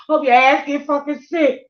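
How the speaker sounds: loud, high hex-like curse. Retold or imitated. Cursing whoever took her food, loud high curse. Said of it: "to scare people".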